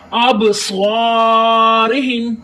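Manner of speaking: ابصارہم very slow, long heavy saa and short ri; stretched ابصارہم on a held note; whistled saad. Shown as a demonstration. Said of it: "سواد کو سٹی کی آواز دینی ہے".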